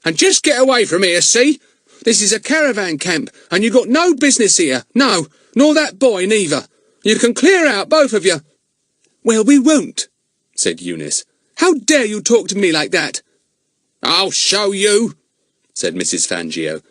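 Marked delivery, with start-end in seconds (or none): 0.0-8.4 high, loud, scolding crone voice; bad-tempered old woman. A narrator's reading. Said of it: "in a very bad temper". 9.2-13.2 put-on high, indignant girl's voice; defiant girl. A narrator's reading. Eunice defiant. 14.0-15.2 slow, threatening crone voice; menacing old woman. A narrator's reading. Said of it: "in a very bad temper".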